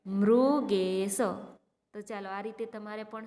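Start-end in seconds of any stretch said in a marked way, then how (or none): 0.1-1.2 Mrugesh stretched slowly syllable by syllable; slow teacher model reading. Shown as a demonstration. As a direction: stretch the word out for the class. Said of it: "જોઈજો, હું વાંચું છું".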